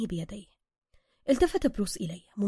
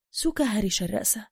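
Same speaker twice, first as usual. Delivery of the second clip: usual speaking voice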